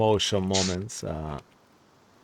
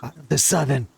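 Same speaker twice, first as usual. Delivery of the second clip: put-on shaken soldier, light Russian accent, higher pitch; half Russian accent; 5 semitones higher than usual. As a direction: recount the horror with a hint of Russian. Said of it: "half Russian accent now".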